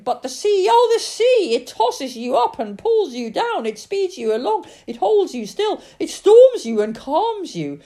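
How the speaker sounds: high, loud, rapturous shout; loud, soaring, sing-out delivery. A narrator's reading. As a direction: shout it exultantly over the wind. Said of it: "she shouted to him".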